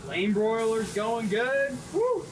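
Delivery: slow, high cheer then whoop; excited whoop at the grill. Real, not acted.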